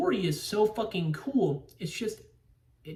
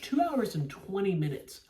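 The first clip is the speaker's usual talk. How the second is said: normal talking voice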